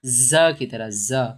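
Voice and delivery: ज़ as a voiced "za"; buzzed z sound. Shown as a demonstration. Teacher showing how it is pronounced, ज़ as "za". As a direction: buzz the start of ज़ like English z. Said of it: "कैसे pronounce होगा ये".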